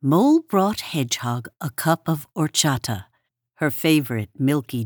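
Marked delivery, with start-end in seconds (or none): none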